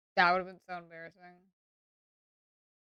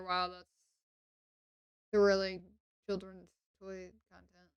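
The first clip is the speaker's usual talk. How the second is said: softer, unimpressed wow; deadpan sarcasm; 7 dB quieter than usual. Real, not acted. Streamer commenting on children's toy content, deadpan sarcasm. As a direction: dryly pretend to be thrilled.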